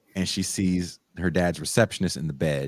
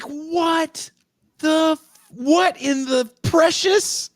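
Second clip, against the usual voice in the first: shocked; 3 dB louder, much slower than usual. Retold or imitated. Shocked viewer reaction.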